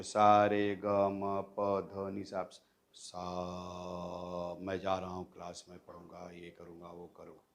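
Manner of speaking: low, soft, level drone of sa; low sa pitch. Shown as a demonstration. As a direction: hold one low flat note. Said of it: "विभिन्न स्वरों पर जोर देकर बोलना". (नीचे सा के सुर पर धीमे बोलना)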